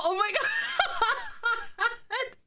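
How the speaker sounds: a real laugh, breaking up mid-phrase; squealing laugh. Real, not acted. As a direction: dissolve into laughter at the pug. Squealing at a pug photo.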